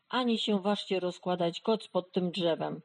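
ordinary delivery, nothing marked

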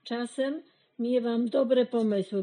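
usual speaking voice

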